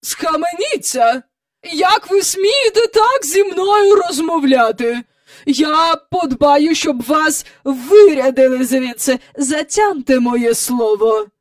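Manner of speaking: high, loud indignation; outraged threat. A narrator's reading. Miss Andrew threatening the nanny with dismissal, outraged. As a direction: threaten with slow, weighty emphasis.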